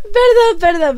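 high, loud tearful apology; very emotional. Real, not acted. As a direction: blurt the apology, still sobbing. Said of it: "muy, muy emocionada". (perdón agudo, llorando de emoción)

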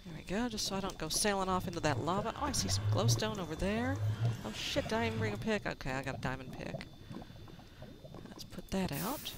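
usual speaking voice

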